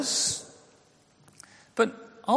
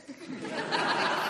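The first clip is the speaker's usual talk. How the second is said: soft breathy laugh; 11 semitones higher, 8 dB quieter than usual. Real, not acted. Laughing at his zip Bible.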